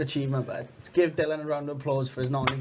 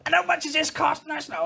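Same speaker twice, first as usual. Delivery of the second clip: much higher-pitched imitation of the missus; mock high-pitched demand; retold outraged question; 14 semitones higher, 3 dB louder than usual. Missus asks about the cost of a fine, mock outraged.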